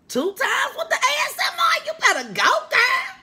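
high, loud, laughing cheer; laughing shout. Real, not acted. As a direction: hype herself up through laughter.

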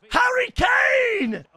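high, slow drawn-out yell; playground scream. Retold or imitated. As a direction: scream the name as you kick the ball. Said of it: "they're screaming what?".

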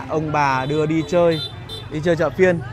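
ordinary talk, nothing marked

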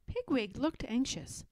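plain reading voice

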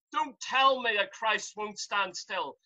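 normal talking voice

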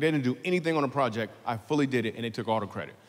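ordinary talk, nothing marked

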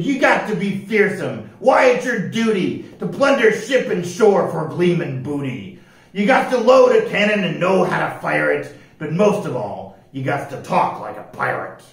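loud pirate rule-giving, easing at the end; glaring pirate captain. A narrator's reading. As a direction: lay down the law as a pirate captain. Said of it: "Pete glared at the rascals and said".

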